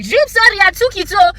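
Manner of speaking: high, loud mock apology; fake-sorry parking thief; mocking spot thief. Impersonating a careless driver, high mock apology. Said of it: "people be like".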